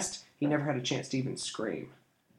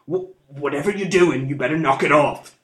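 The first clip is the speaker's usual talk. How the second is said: louder, halting scared threat; frightened stammer; 3 semitones higher, 8 dB louder than usual. A narrator's reading. Thief scared by the glowing eyes, stammering. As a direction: stutter out a threat in panic. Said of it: "he stammered".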